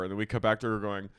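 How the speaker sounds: ordinary talk, nothing marked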